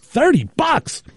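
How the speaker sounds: slow, drawn-out disbelief, pitch up; incredulous echo. Retold or imitated. Retelling his reaction to the thirty-buck tire price, slow incredulous echo. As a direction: stretch it out like you can't believe the price.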